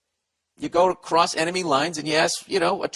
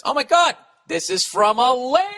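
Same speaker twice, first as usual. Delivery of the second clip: much higher, louder gasp of surprise; surprised; 10 semitones higher, 4 dB louder than usual. Real, not acted. Host surprised that a woman sent in a question, high excited exclaim. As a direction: react like you can't believe a woman wrote in. Surprised at a female letter writer.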